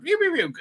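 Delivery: high mock blaster sound pew pew pew; blaster noises. Retelling the droid firing at them, high mock blaster sounds. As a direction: squeak out the laser shots like a kid.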